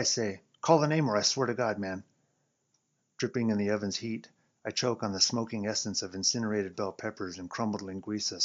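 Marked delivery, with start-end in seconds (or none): 0.6-2.0 loud exasperated threat; angry threat. Acted. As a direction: push him hard. Threatening coworker.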